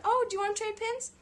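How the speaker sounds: put-on high eager childlike voice; an excited kid asking; little girl. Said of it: "she said".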